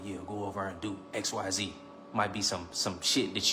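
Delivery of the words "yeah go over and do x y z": soft and low, like a sly whisper in your ear; a hint of a tempter; tempting inner voice. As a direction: lure yourself into it quietly. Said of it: "your lower nature might be like".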